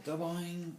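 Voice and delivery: high, springy boing; bouncing-ball noise. A narrator's reading.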